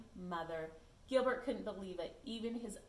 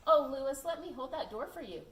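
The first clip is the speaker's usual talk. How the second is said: loud, higher fake courtesy; sly politeness; 4 semitones higher, 8 dB louder than usual. Gilbert baiting Lewis, loud and higher fake courtesy. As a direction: overdo the manners to seem suspicious. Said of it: "Gilbert said".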